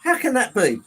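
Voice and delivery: high, loud dismay; shocked disbelief. Real, not acted. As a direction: cry out in disbelief at awful news. Dismayed at news maker has closed.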